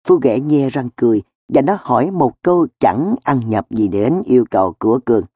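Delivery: ordinary delivery, nothing marked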